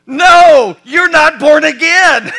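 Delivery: loud, high-pitched comic outburst; mock shouted rebuttal. Preacher jokingly correcting a pastor's son, loud mock shout. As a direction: blurt it out as a booming mock correction.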